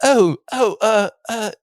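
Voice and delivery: high, hesitant mock British accent; fumbling amateur British impression; Stephen Grant. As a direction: stammer it in a put-on English accent. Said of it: "do your best Stephen Grant impression".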